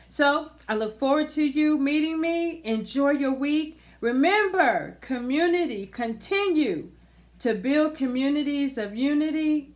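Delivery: rally the community with energy; upbeat sign-off. Real, not acted.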